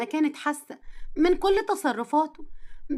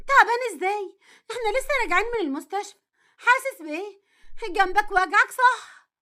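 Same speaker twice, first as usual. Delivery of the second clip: alarmed and worried; 5 semitones higher than usual. Acted. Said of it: "اتخضت وجريت عليه بلهفة".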